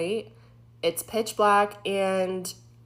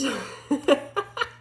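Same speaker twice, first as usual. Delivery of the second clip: high-pitched real nervous laughter; uneasy laugh breaking into the story; 8 semitones higher than usual. Real, not acted. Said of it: "nervous laughing just thinking about it".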